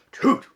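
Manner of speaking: loud toot like a little horn; tooting rhino noise. Acted. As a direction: toot it out like a trumpet. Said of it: "She made funny sounds".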